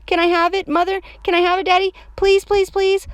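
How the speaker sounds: put-on loud, high child's plea; high, insistent little-kid pleading; urgent begging. A narrator's reading. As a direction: beg urgently like an overexcited kid. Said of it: "very urgent".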